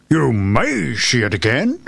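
slow, odd creature voice; frog voice. A narrator's reading. Frog offers hope to the princess, in frog voice. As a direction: draw it out as a talking frog. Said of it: "replied the frog".

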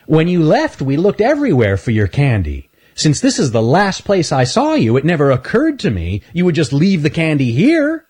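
high, amazed critter voice; impressed at a clever trick; Earl's voice. Acted. Earl marvels at Stanley's hiding place, high and amazed.

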